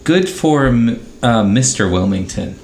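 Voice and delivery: ordinary talk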